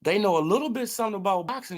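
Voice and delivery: quick emphatic restatement, x1.6 pace; hammering the point home quickly; rushed repeat. Real, not acted. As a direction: fire it off fast.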